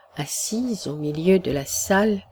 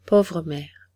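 sighing grief. A narrator's reading. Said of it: "avec un profond soupir".

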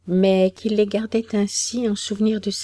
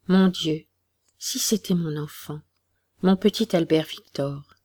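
ordinary delivery

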